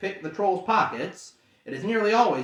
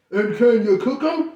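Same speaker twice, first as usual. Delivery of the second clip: louder greedy troll voice; 6 semitones higher, 8 dB louder than usual. Acted. Troll Tom asking to cook the hobbit, greedy. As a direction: ask it hungrily. Troll asks about cooking.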